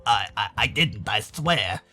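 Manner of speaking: low stammering plea; timid, halting protest; nervous denial. Acted. Roswell denies it, cornered.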